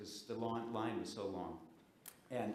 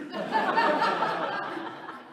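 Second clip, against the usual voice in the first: burst of laughter; 16 dB louder than usual. Real, not acted. Laughing at own story.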